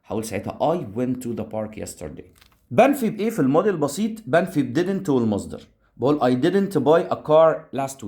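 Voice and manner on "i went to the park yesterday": slower and softer, settled reading of the right sentence; teacher giving the fix; corrected version. Shown as a demonstration. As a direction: give the correct answer calmly. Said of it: "أنا أذهب ولا I went".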